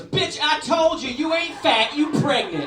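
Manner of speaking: big high yell, drawn out. Retold or imitated. Comic voicing a woman yelling on the Red Line, loud high shout. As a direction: shout it across the train car, hammed up. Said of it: "this woman yelled, she goes".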